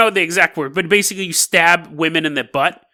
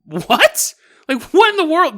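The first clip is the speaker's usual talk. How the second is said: confused son; 10 semitones higher than usual. Retold or imitated. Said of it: "being like".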